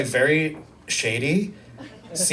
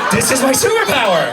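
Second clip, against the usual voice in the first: high, fast exultant cry; triumphant realisation; 7 semitones higher, faster than usual. Acted. Comedian announces newfound power to crowd, triumphant. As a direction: proclaim it in gleeful triumph, high and quick.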